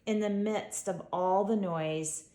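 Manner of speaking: plain reading voice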